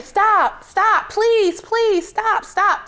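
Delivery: high, loud desperate screaming; frantic high shouts of stop. Retold or imitated. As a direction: yell at the bus driver to stop in panic. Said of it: "And I'm screaming".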